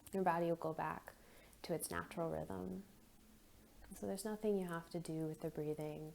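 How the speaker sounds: soft, unhurried meditation voice with long pauses; guided meditation. Acted. Leads a guided breathing meditation.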